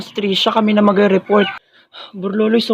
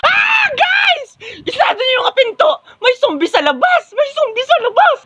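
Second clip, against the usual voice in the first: very high frantic shriek; panicked screaming. Acted. Student warning classmates of zombies outside, panicked screaming.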